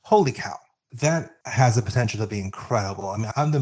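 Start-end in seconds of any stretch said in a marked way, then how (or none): 0.0-0.6 high, loud burst of amazement; holy cow, wide-eyed and louder; amazed. Real, not acted. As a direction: blurt it out, floored by the news. Reacting to the halftime lineup.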